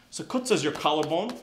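normal talking voice

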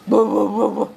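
loud punchy woofs; playful mock dog bark; imitated barking. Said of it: "Eh, gonggong, gonggong".

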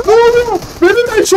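high startled cry; sudden alarmed yelp; shocked. Real, not acted. (şaşkın yüksek çığlık)

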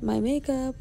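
high playful correction. Real, not acted. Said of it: "or rather our makeup, sorry".

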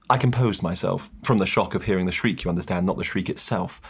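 ordinary talk, nothing marked

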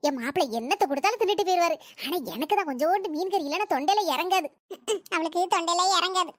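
ordinary talk, nothing marked

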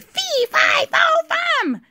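very high-pitched mock shout; sudden shout. A narrator's reading. Trina suddenly startles Will, high mock shout. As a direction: shriek the chant without real volume. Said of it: "she shouted".

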